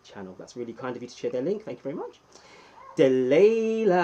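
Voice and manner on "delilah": loud, high, drawn-out name call; announcer call. Acted. Streamer hyping his wife's arrival, booming name call. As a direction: stretch the name like a ring announcer.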